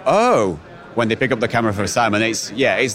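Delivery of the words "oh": imitated "oh" of pleasant surprise; mock impressed gasp of realisation; impressed reaction. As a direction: mimic someone discovering something, higher pitched. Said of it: "you see people go".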